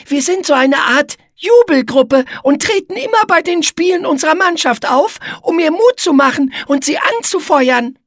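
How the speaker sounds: very high, squeaky explaining voice; high-pitched, keen character voice; Igitte, eager spider. Acted.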